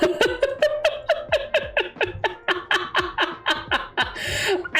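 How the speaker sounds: long, high cackling laugh; helpless peals of laughter; laughing fit. Real, not acted. Streamer cracks up at own Florida remark, laughing hard.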